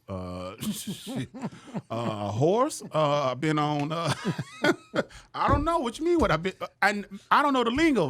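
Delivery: high, laughing, stumbling answer; flustered laughter. Retold or imitated. Host asks his credits, flustered and laughing. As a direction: laugh off the confusion.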